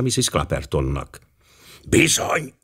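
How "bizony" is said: put-on high-pitched old man's voice; aged passenger. A narrator's reading. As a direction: agree in an old gentleman's high voice. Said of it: "folytatta az agg utas". (magas öregúr-hang)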